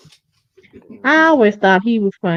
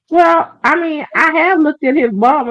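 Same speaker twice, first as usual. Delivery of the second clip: high, loud blurted admission; hammed-up loud confession; mock confession; 8 semitones higher, 5 dB louder than usual. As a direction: blurt it out loudly. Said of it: "then he said".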